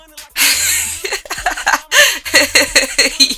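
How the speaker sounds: long, high-pitched giggling laugh; real laughing fit. Real, not acted. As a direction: crack up at your own story. Cracks up at her own story.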